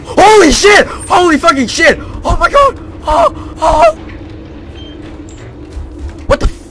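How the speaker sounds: real jump-scare panic; panicked screaming. Real, not acted. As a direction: shriek in fright.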